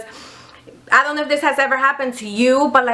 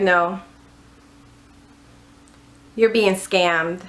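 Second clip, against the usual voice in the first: grave eBay agent; 5 semitones lower, slower than usual. Retold or imitated. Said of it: "They're like".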